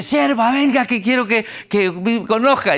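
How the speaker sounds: high, ringing call of the Lord. Retold or imitated. The Lord calls his servant to come, high and ringing. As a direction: call her over like the Lord himself.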